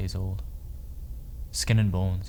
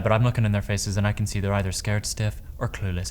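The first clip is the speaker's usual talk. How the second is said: normal talking voice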